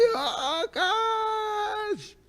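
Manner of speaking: high, soft startled gasp at the skunk; shocked cry. Retold or imitated. Man jumps at a passing skunk, startled cry. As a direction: gasp it out like a fright. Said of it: "I say".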